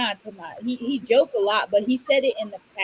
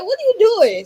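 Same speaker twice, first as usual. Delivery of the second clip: high, loud startled "what are you doing"; sudden loud, high confused question; 11 semitones higher, 8 dB louder than usual. Retold or imitated. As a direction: shout it out in surprise. Friend's puzzled question.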